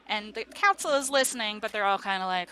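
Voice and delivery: normal talking voice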